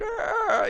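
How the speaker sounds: high-pitched mock agonized groan; imitated melting groan. Warning about melted snapshot limbs, mock agonized groan. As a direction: groan like something mangled, hammed up.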